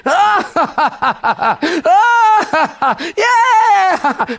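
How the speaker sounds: high, hammed-up cackling whoops; mock spirit laughter. Showing people laughing on the church carpet, hammed-up. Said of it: "if you felt like laughing in the Spirit".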